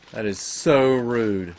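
slow, drawn-out indignant; mock offended. Real, not acted. Gamer scolding the attacker, slow and sulky. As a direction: sulk at it slowly. Complaining to creature.

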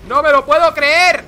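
high, loud outburst of disbelief; shout of disbelief. Real, not acted. Streamer watching a 2v1 kill, shouting in disbelief. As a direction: explode with disbelief. Stunned at teammate's death.

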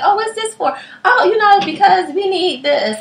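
high, playful voices trading casual lines; mock naive back-and-forth. Trusting her friend while signing papers, high playful voice. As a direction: toss it off breezily. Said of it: "I'm signing stuff like".

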